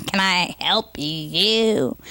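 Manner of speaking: put-on slow, sour old-lady drawl; sour receptionist. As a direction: sound like she sucks lemons all day.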